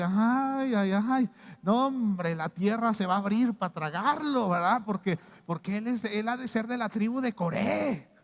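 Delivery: high, loud hammed-up self-righteous voice; mock outraged zealot. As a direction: play the finger-pointing zealot gleefully calling down doom. Preacher mocks judgmental Bible reading.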